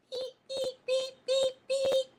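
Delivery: high mock horn beeps; high beeping. Preacher beeping like a little tugboat, high mock beeps.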